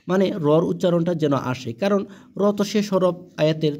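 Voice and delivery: normal talking voice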